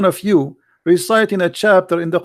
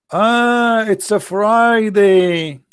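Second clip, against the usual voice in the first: slow drawn-out; 3 semitones higher, much slower than usual. Pretend. Drawn-out mocking Friday.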